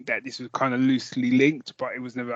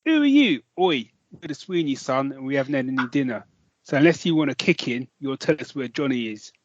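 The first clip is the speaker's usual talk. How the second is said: fake London accent. Shown as a demonstration. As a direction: bark it in mock London police-drama style. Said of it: "when I'm doing my fake London accent".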